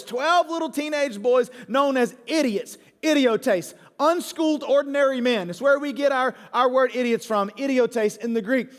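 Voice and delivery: usual speaking voice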